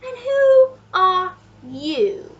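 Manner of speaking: very slow, high, loud question; duke demanding. A narrator's reading. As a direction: boom it word by word at a stranger. Duke interrogates the narrator, pointing.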